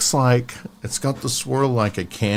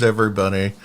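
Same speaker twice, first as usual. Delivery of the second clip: usual speaking voice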